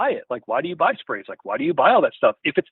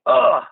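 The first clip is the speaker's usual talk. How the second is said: a short, higher, louder groan of annoyance; frustrated groan; 8 semitones higher, 4 dB louder than usual. Retold or imitated. Hunter telling his girlfriend about the wind, with a frustrated groan. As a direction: let out a fed-up ugh.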